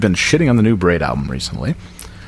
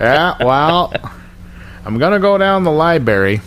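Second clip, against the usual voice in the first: pitched-up upstate twang, library as laibery; Glens Falls voice; 6 semitones higher than usual. Retold or imitated. Imitating locals around the house in a Glens Falls voice. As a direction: play a small-town Glens Falls local. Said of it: "using that voice around the house".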